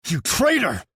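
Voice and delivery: high, loud cry of betrayal; furious shout. A narrator's reading. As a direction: hurl it in his face. Enraged at betraying brother.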